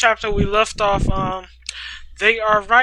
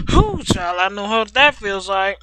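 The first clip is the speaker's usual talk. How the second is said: ordinary delivery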